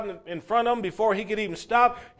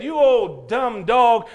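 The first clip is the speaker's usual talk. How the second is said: put-on slow, louder scornful kid's jeer; retold boy; 4 semitones higher, 8 dB louder, slower than usual. Boy kicking the wrong dog, loud and slow scorn. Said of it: "kicked him and said".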